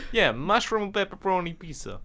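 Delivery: put-on New Yorker voice; Brooklyn accent. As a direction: repeat the order as a Brooklyn local. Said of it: "Australian or Brooklyn, New York".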